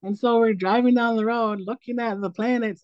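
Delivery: ordinary talk, nothing marked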